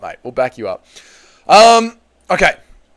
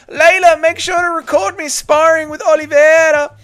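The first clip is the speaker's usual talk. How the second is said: high, whiny mocking voice; mocking Ian Garry; 12 semitones higher, 3 dB louder than usual. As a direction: whine it out like a show-off. Mocking Ian Garry to his wife.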